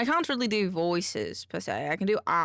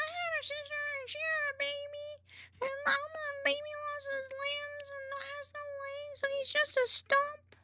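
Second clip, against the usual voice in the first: much higher, thin comedic character voice; high put-on character voice; 4 dB quieter than usual. Shown as a demonstration. Streamer trying her one funny voice for viewers, high and far from her own. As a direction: spin a grim joke in a squeaky voice. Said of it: "I love that voice".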